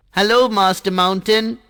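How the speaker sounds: put-on high, sweet child voice, flattering; butter up the Sun in a high bright voice; little water drop sweet-talking the Sun, voiced high; Jal the water drop.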